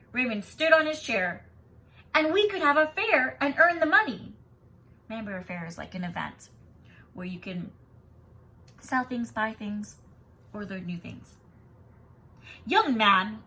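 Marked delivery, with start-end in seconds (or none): 2.1-4.3 eager shout. A narrator's reading. Raymond on chair addressing school. 12.6-13.4 warning. A narrator's reading. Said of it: "warned the principal".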